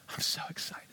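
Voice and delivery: soft unvoiced whisper; a hushed, excited whisper. Shown as a demonstration. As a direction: breathe it out as a secret. Example line on invite card.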